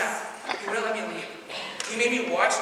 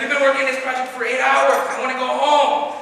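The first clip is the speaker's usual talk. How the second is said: raised, louder griping; complaining; 5 semitones higher, 6 dB louder than usual. Retold or imitated. Comic imitating a tired classmate wanting home, loudly complaining. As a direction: stretch out the grievance. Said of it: "he complains about everything".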